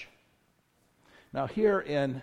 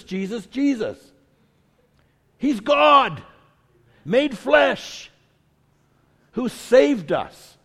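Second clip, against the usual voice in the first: higher, louder, fervent preacher's call; full-force preaching; 6 semitones higher, 7 dB louder than usual. Real, not acted. Preacher rallies the church about Jesus, full-force preaching. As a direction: lift it up and drive it home.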